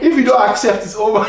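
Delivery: hammed-up old-self demand; mock ultimatum. Husband mocking his old angry self to his wife, hammed-up shout. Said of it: "Bro, it was crazy".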